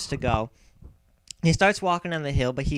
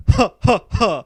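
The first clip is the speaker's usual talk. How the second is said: giant laugh; 6 semitones higher, 6 dB louder than usual. Acted.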